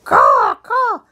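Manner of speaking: high, loud bird squawk; noisy raven. Pretend. Grandparent's raven butts into the bedtime show, noisy squawking. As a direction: caw out like a pushy crow. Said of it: "he thinks he's a crow".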